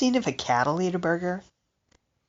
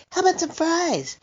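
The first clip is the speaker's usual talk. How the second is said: put-on high, soft baby talk to a kitty; cooing to the cat; 11 semitones higher, 4 dB quieter than usual. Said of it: "She kinda knows the words".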